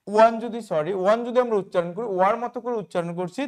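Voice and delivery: ordinary talk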